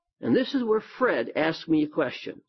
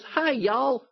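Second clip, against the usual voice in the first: Southern greeting; 8 semitones higher than usual. Retold or imitated. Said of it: "he says".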